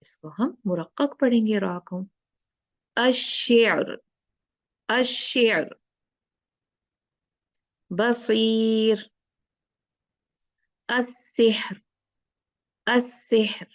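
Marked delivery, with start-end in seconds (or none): 3.0-13.7 each word drawn out, light र; slow recitation. Shown as a demonstration. Teacher recites light-ra examples for the class. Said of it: "recitation examples".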